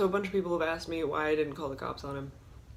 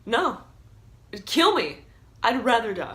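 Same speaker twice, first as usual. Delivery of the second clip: higher, loud, daring; defiant dare; 3 semitones higher, 5 dB louder than usual. Retold or imitated. To the robber, loud defiant dare. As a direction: challenge him to do it. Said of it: "And I'm just like".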